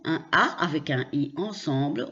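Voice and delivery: normal talking voice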